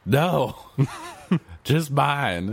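high, slow goofy zombie voice. Pretend. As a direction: groan it out like a silly zombie. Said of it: "being a zombie".

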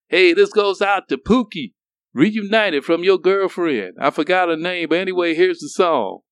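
loud, high, slow radio shout-out; mock DJ dedication. Young DJ dedicates song to Pookie, loud announcer voice. Acted on-air dedication to listener.

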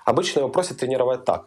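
ordinary talk

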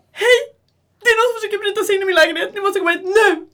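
hammed-up panic, urgent and high-pitched; retold panicked call. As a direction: act out a frantic emergency call. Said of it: "Jag är skiträdd".

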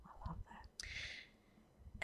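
soft airy whisper. Real, not acted. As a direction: whisper it back. Whispered reply.

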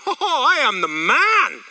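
drawn-out, swaggering mock boast; cocky self-mockery. Pastor mocking his younger self as the man, slowly swaggering. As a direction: play yourself as the big man in America. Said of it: "I'm like".

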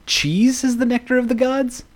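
high, disbelieving echo; incredulous. Real, not acted. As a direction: repeat it like you can't believe it.

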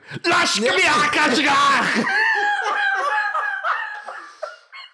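high, loud guttural German gibberish; mock German shouting. German's turn in the language joke, shouted mock German. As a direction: explode into fake German, then laugh.

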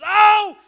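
sudden loud high "Oh!" of pain; loud startled cry of pain; pained yelp. Retold or imitated. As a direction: yelp as your leg gets crushed. Balaam hurt, yelps at donkey.

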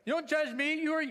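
high, loud angry squeal, imitated; shrill mock outrage of the smoking girls, mimicked. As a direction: mimic the girls yelling back at him, pitched high. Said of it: "they're getting upset. They're pissed".

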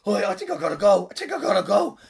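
loud, high, gasping excuse; acted panicked escape. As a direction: cry it out, desperate to get away. Faked allergy attack, excuse to flee.

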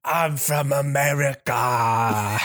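slow, drawn-out British claim to be American; hammed-up British accent, stretching the last word. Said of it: "Not even trying with an American accent".

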